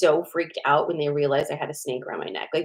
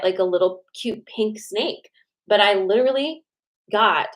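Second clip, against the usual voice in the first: usual speaking voice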